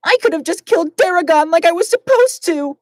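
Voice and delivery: high, bitter self-blame; high Glacia voice. Acted. Glacia blaming herself, high voice.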